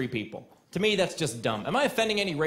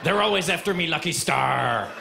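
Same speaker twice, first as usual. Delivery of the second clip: a hint of a pirate growl, dragged out; 4 dB louder than usual. Comic aside to the crowd, a mild pirate accent. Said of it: "said that part like a pirate".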